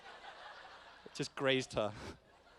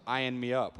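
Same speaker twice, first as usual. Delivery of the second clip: ordinary talk, nothing marked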